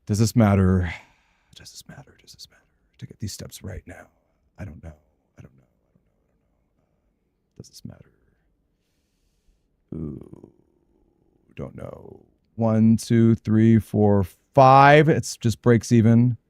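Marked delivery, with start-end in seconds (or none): 1.6-2.2 breathy whispered question to himself. Real, not acted. Gamer whispering a question to himself. Muttering to himself over game. 2.2-12.2 unsure whispered muttering. Real, not acted. Stuck on a puzzle, whispering doubts to himself. Agonising over a game choice.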